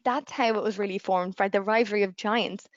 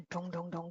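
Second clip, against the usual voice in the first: low, hushed dong-dong-dong; imitated door knock; 5 semitones lower, 15 dB quieter than usual. Storyteller imitating the knock at the door, low and hushed. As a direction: voice a soft, low knocking sound.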